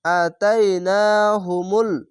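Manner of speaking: আতাইনাহুমুল slow, each long vowel pulled; teacher showing the alif stretch. Shown as a demonstration. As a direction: draw out আতাইনাহুমুল syllable by syllable. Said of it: "খাড় জাবারে এক আলিফ টান".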